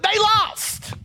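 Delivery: high, drawn-out gloat on the last word; mock gloating. Preacher teasing the English, mock high-pitched gloat. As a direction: crow it in a high stretched voice. Mock American boasting over England.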